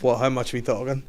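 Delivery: usual speaking voice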